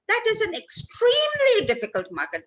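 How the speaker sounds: "extremely" pushed up high and long; stress on extremely. Shown as a demonstration. Trainer shows students how stress changes meaning, "extremely" hit hard. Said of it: "That means more than difficult".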